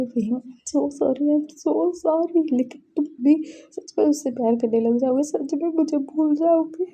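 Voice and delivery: mock-tearful confession to his sister; crying apology. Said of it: "बोल के रो रहा था". (बहन से रोते हुए माफ़ी, हल्का अभिनय)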